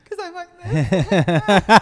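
slow, laughing through the words; laughing at herself. Real, not acted. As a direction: let the laugh carry it. Laughing while retelling.

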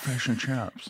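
soft celebrity impression; hushed mimicry of a film star; Owen Wilson. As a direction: say it quietly as the imitated actor. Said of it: "like Owen Wilson was doing it".

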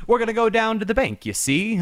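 hammed-up mid-Atlantic accent, 1930s film style; mock mid-Atlantic accent like an old talkie; mid-Atlantic gangster. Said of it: "it's like that type of thing".

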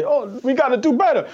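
high-pitched mock football star; Randy Moss. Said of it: "come in with his, uh, with his Snuggie on and say".

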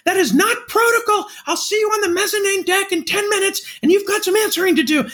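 high, strained pretend scolding; mock yell. Passing security in a pretend phone argument, mock yell. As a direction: yell it high, as if mid-argument, without real volume. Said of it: "you yell out".

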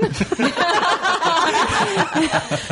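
shrill witchy cackle burst; loud cackle. Real, not acted. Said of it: "Boy, that was loud".

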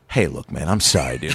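low, placating apology; peacekeeper. Retold or imitated. Driver apologises to toll guy, low and placating. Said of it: "I'm trying to be the peacekeeper".

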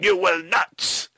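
a mock stern master forbidding his thrall; stern vampire command. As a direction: lay down the law like a vampire master. Voicing Blackula forbidding.

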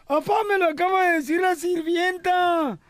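put-on high child's voice calling dad; a kid begging his father, high and pushed; scared little kid. Child telling his dad about ghosts, high and pleading.

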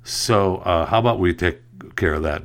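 ordinary talk

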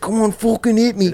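high, put-on Liverpool accent; Liverpool accent impression. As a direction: imitate the Liverpool friend egging him on. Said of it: "I do your accent".

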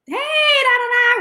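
high, drawn-out sing-song hey; mock greeting. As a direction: imitate a friendly hello trailing into da-da-da. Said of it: "he was like".

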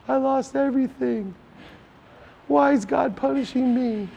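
whiny victim. Retold or imitated. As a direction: play the sorry victim crying to God. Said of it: "where I'm a victim".

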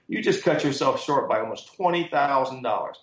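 ordinary delivery

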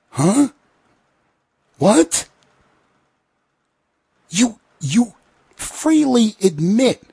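can't believe what he just heard; incredulous disbelief. Real, not acted. Incredulous outburst at pundit.

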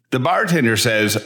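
ordinary talk, nothing marked

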